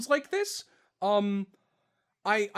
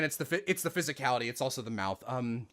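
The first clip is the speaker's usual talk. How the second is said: usual speaking voice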